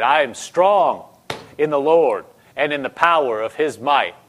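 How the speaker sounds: full-voiced, firm declaration; confident, emphatic confession; rising strength. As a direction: declare it with strength risen up in you. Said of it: "Stuff was just rising up in her".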